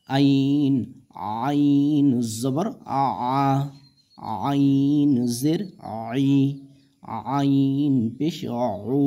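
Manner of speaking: আইন said with pressure from the middle of the throat; pressed throat ain. Shown as a demonstration. Teacher showing students the pressed throat sound of আইন. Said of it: "আইনের উচ্চারণ গলায় চাপ দিয়ে কিন্তু করতে হবে।".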